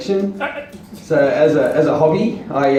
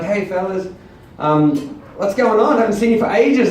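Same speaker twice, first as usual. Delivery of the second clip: faux-casual greeting; 7 semitones higher than usual. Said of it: "and I was like".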